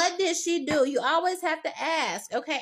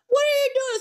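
higher, louder mock yell; teacher blowing up; 8 semitones higher, 4 dB louder than usual. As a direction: snap at the kid who walked in. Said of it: "her first instinct was to blow up on him".

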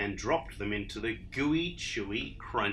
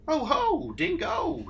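much higher, louder fluttery lady; fluttering Emu; 4 dB louder than usual. Acted. Emu greets Dingo, high and fluttery. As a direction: flutter like a vain bird. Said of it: "she fluttered".

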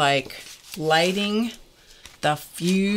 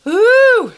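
loud high whew of relief; huge exhale of relief; big whew; 12 dB louder than usual. Real, not acted. Relief after story.

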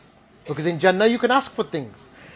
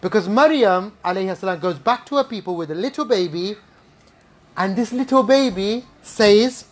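ordinary talk, nothing marked

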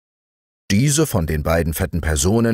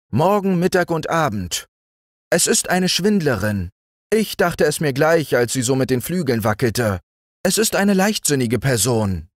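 high, quick gossiping ant; 8 semitones higher than usual. A narrator's reading. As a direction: tattle as the scandalized ant.

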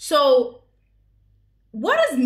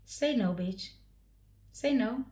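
muttered aside; 8 dB quieter than usual. Real, not acted. Muttering at the proposal scene.

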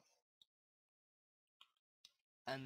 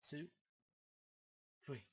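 ordinary talk

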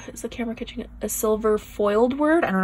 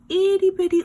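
excited squeal; 10 semitones higher, 6 dB louder than usual. Real, not acted. Marvelling at tiny pieces.

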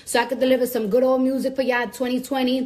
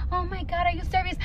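mocking dainty girl, higher and softer; cute, delicate voice; 4 semitones higher, 5 dB quieter than usual.

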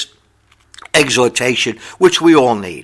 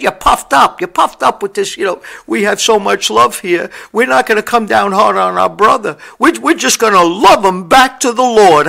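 a touch of Paul's scorn, higher pitch; Paul scolding; 5 semitones higher than usual. Retold or imitated. Paul scolding the Corinthian church, mildly scornful. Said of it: "He said".

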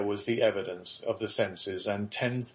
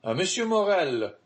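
high, excited call up the stairs; exclaiming; 8 semitones higher, 4 dB louder than usual. A narrator's reading. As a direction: call his name out, pitched well up. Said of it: "exclaimed a voice on the stairs".

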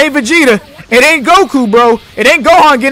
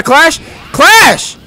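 hype shout; 7 semitones higher, 4 dB louder than usual. Real, not acted. Pressing to clash in game.

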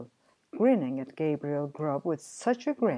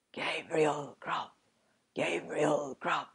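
wild spectral chorus; 3 dB quieter than usual. A narrator's reading. Goblin chorus taunts the sexton, eerie chanting. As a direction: chant the name slowly like unseen spirits. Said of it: "exclaimed the wild voices again".

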